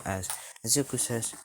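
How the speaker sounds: ordinary delivery, nothing marked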